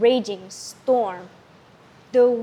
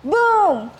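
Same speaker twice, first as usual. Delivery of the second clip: a big, high "Boom!" for thunder; booming sound effect; 7 semitones higher, 4 dB louder than usual. A narrator's reading. Narrator booms out the thunder crash.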